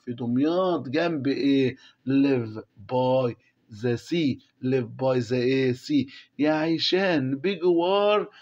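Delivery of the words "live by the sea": slow reading aloud. Shown as a demonstration. Teacher reading the text to the class, very slowly. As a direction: read each English word very slowly for the class.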